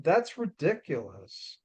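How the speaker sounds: normal talking voice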